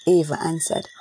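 ordinary delivery

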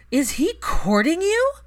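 high, loud and slow, scandalized; Pansy can't believe it; shocked high question, drawn out; shocked gasp question. Acted.

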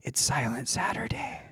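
hushed reminder whisper; breathy hush of a rule. Retold or imitated. Reminded at quiet soccer game.

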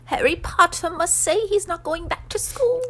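high, loud elf voice; insistent Dobby. A narrator's reading. House-elf holds pudding to ransom, high and insistent. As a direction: insist as a squeaky little elf. Dobby demands Harry's promise.

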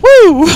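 high, loud "woo"; short ringing whoop; excited whoop. Real, not acted. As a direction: let out a sudden cheer. Cheering after retold exchange.

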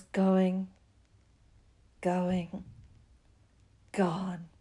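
"going, going, gone" slowly, with long pauses; suspenseful countdown. A narrator's reading. Narrator watches the dragon fly away, suspenseful pauses. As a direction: leave a long gap before each "going" and "gone".

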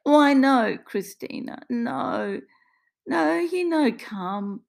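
high, distressed protest; pleading Italian-accented dismay; fighting back tears. Acted. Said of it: "Maria is fighting back tears now".

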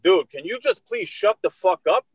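high, fed-up retort; exasperated plea. Retold or imitated. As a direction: act out losing patience with an interrupter. Retold plea to interrupting man.

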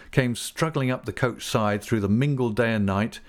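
plain reading voice

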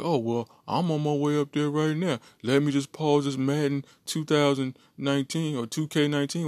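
put-on high, hyped-up young gamer boyfriend, fast at the end; mock boyfriend. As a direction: ham up the eager boyfriend.

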